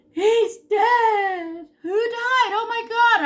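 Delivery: high, loud crying wail. Retold or imitated. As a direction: wail it through tears. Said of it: "I'm crying".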